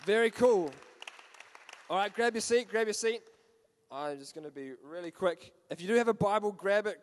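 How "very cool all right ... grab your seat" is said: loud, raised-pitch call; calling to the room. Real, not acted. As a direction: call out to get everyone seated.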